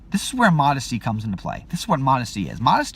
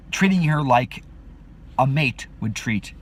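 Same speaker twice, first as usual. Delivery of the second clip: normal talking voice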